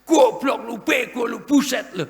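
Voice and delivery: shouted insults, high and loud, right in someone's face; angry yelling. Acted. Preacher acting an angry man who yells at someone nearby. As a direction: bark the insults like a furious man standing close. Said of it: "Deket kok teriak".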